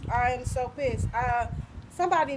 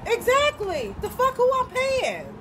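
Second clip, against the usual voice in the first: high, raised-voice outrage; upset, pitched-up protest; indignant; 9 semitones higher, 4 dB louder than usual. Real, not acted. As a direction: throw the question out in real anger. Indignant about being towed.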